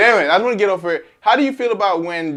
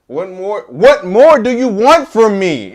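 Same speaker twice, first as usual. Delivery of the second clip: high, loud, slow whiny wail; [name] crying voice; 6 semitones higher, 11 dB louder, slower than usual. Retold or imitated. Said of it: "In the [name] voice".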